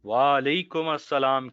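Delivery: ordinary talk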